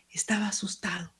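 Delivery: breathy hushed whisper of fright; whispered fear. A narrator's reading. Boy telling how he froze in the doorway, whispered. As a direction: drop to a scared whisper. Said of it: "narrator hushes on fear".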